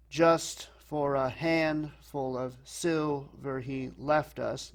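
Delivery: slow scansion, heavy "Just", "handful", "silver", "left"; DUM-da-da beat, hit "Just" and the first syllable of "handful"; dactylic stress. Shown as a demonstration. Teacher showing stressed, unstressed, unstressed, slow dactylic beat from "Just". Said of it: "stressed, unstressed, unstressed".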